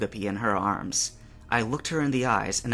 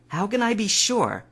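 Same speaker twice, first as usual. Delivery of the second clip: much higher, pleading doubt; Misty's voice; 10 semitones higher, 3 dB louder than usual. A narrator's reading. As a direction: ask it like you fear the answer.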